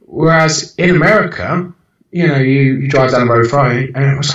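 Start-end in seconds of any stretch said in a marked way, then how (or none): none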